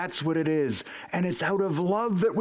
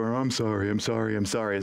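lower-pitched, repetitive imitation of apologizing; mock wimpy apology; 4 semitones lower than usual. Saying sorry to everybody, mock wimpy. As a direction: lightly imitate someone caving in with apologies. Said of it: "some sort of wimpiness".

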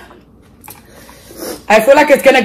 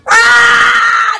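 pained cry; 12 semitones higher than usual. Real, not acted. Pain from chili.

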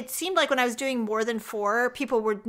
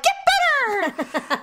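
demanding; 3 dB louder, much slower than usual. Retold or imitated. Snapping at producer.

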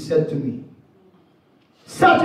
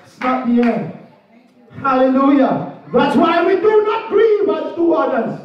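rising, higher pulpit proclamation with hallelujahs; preaching; 4 semitones higher than usual. Acted. Preacher wrapping up a funeral sermon, rising proclamation. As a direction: lift the congregation, declare death is not the end.

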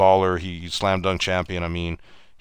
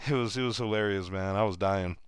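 ordinary delivery

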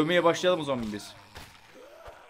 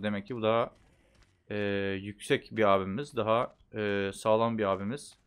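ordinary talk

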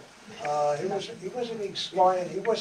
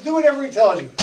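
commanding mother; 7 semitones higher, 10 dB louder, faster than usual. Retold or imitated. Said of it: "and says".

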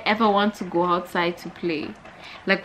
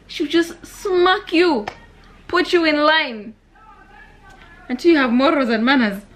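emphatic telling; 8 semitones higher, 6 dB louder than usual. Real, not acted. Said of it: "emphatic about strict aunt".

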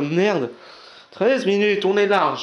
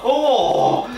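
loud high Oh, thrown out; excited shout; 8 semitones higher, 7 dB louder than usual. Acted. Vlogger mocking fan video, excited shout. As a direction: yelp it like a fan.

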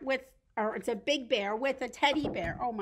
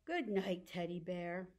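lower, softer, winding down; soft goodnight; 5 semitones lower, 8 dB quieter than usual. Pretend. Storyteller puts teddy to bed, soft goodnight. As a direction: hush it like bedtime.

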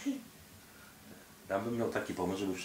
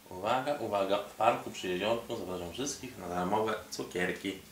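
normal talking voice

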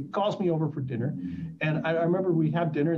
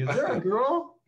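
high, rising mock interrogation; suspicious mother; 9 semitones higher, slower than usual. Mother questioning her son's career choice, suspicious. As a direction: do the prying mother routine, pitched up. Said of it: "She does the".

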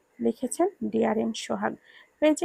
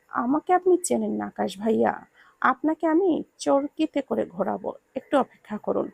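usual speaking voice

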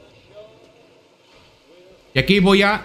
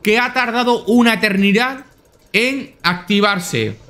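relieved surprise; 6 semitones higher than usual. Real, not acted. Narrow escape in game.

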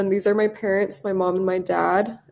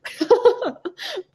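giggle; 13 semitones higher than usual. Real, not acted. Laughing after wrong picture.

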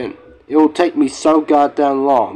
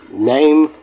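ordinary talk, nothing marked

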